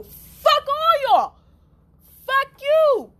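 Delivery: high, loud, slow furious yelling; mock enraged shout; retold furious yell. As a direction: scream it out at them in rage. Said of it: "I got so mad".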